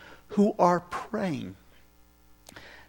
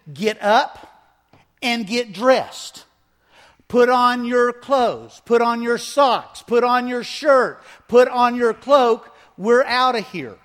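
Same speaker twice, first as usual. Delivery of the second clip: louder, slow, firm orders to hurry; urgent angel commands; 5 semitones higher, 5 dB louder than usual. Retold or imitated. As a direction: rouse him and hurry him out, each line pushed. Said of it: "And then he told him".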